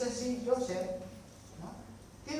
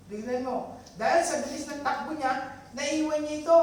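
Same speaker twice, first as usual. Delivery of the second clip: hint of an indignant accuser; 7 semitones higher, 7 dB louder than usual. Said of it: "wife's false accusation".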